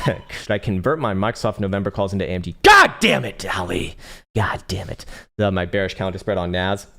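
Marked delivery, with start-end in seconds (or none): 2.6-4.0 real exasperated yell at a viewer; frustrated outburst. Real, not acted. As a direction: snap it out in exasperation.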